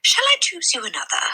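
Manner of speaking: high, keen offer; lightly voiced eager librarian. A narrator's reading. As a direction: offer it with delight. Mrs Phelps offering.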